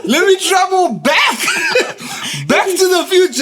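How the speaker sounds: high, laughing shout through giggles. Real, not acted. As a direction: laugh the words out loud. Joking about fixing bank account.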